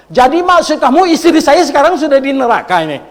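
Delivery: loud, high, furious retort; angry shout. Retold or imitated. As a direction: shout it like an offended grandfather. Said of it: "Langsung dia bilang". (bentakan marah kakek yang tersinggung)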